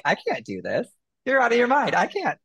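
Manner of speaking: mock-overwhelmed, pitch leaping up midway; retold protest. Author balking at the editor's revisions, mock-panicked protest. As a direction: protest as if asked the impossible, half in fun.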